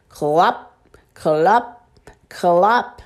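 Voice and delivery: low, spaced clops with a rise; low hoofbeat imitation; horse-hoof clops. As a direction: clop like horse feet, low and measured. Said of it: "sound like a horse feet".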